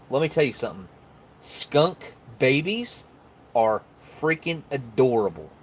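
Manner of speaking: ordinary delivery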